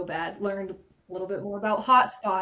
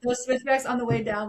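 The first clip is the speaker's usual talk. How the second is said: ordinary talk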